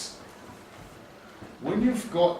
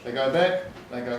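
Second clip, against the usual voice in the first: normal talking voice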